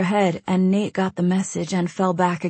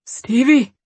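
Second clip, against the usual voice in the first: giddy; 7 semitones higher, 5 dB louder than usual. Acted. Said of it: "a lightness in his voice".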